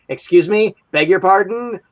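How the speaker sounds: a lightly played Terminator menu line; mock polite robot option. Terminator reading options off its screen, mock prissy politeness. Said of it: "the screen go of all of his possible options".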